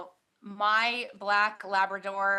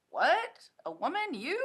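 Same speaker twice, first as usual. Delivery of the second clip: mock surprise; 7 semitones higher than usual. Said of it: "and they're like".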